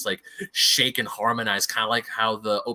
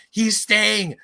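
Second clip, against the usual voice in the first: Disa; 9 semitones higher, 6 dB louder than usual. Retold or imitated. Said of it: "she looks at Dor-, at Dor and goes".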